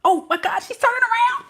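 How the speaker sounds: high, squeaky alarm; panicky. Acted. Shy admirer about to be caught looking, high-pitched panic. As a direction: shoot up in pitch in mock panic.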